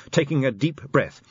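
plain reading voice

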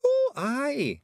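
high, cheery pixie voice; cheerful. A narrator's reading. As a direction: chirp it out happily. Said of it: "said Rob cheerfully".

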